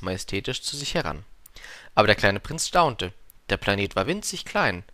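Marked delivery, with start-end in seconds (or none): none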